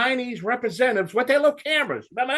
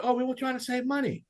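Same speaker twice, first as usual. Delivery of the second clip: mimicked manufacturers' excuse; 5 semitones higher, 7 dB quieter than usual. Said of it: "What are you doing?".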